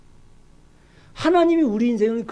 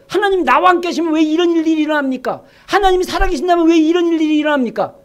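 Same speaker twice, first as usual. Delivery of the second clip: higher-pitched, insistent questioning; argumentative protest; 5 semitones higher than usual. Acted. Gideon arguing back at the angel, indignant. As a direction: challenge God, pressing each why. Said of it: "오히려 막 따져요".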